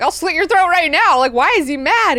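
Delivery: high, loud mock threat; hammed-up furious interrogation; threatening. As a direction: confront him with aggressive demands. Guards threaten the servant, acted.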